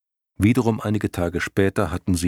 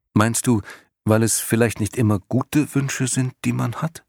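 put-on higher, curious young boy; probing boy voice; 5 semitones higher than usual. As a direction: ask probingly like a child. Bastian probes the lion. (neugierige Jungenstimme)

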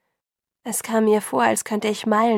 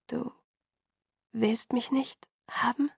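slow, halting, softer question; confused, word by word with long pauses; trying out the words; 3 semitones higher, 5 dB quieter than usual. A narrator's reading. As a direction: test each word hesitantly. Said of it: "Ich probierte die Worte aus".

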